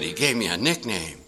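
usual speaking voice

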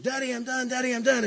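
put-on a small boy eager to show daddy; proud little boy. Boy proudly announces he's finished.

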